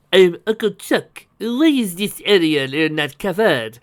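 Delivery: put-on slow, fussy Frenchman's accent; French accent. A narrator's reading. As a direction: ask in a theatrical French accent, drawing out the end. Antoine questions the inventor.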